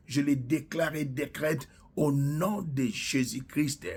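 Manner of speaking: ordinary talk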